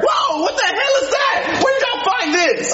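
shocked. Acted. Cook shocked at the kids' find, high shout. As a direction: yell at the sight of it.

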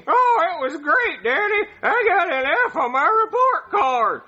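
put-on high silly kid voice; Jeffy voice. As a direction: play a goofy little boy. [name] voicing Jeffy in video.

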